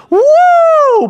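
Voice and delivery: high, loud sustained whoop; crowd's answering woo. Retold or imitated. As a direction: echo the wrestler's "woo" loudly. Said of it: "the congregation responds".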